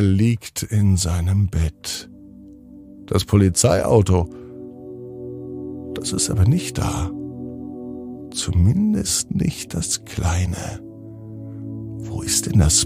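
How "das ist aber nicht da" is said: ordinary delivery, nothing marked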